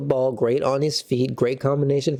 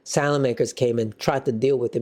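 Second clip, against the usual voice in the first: ordinary delivery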